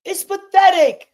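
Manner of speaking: disgusted yell. Real, not acted. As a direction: cry it out in contempt. Disgusted outcry.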